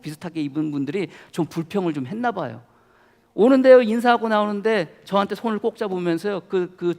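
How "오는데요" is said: ordinary delivery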